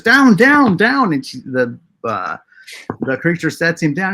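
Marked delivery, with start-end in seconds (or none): 0.0-1.1 pitched-up excited calls of "down"; high, eager cries of "down" to be set on the ground. Acted. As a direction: call "Down, down, down" high and loud like a pointing, eager little man. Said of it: "He goes".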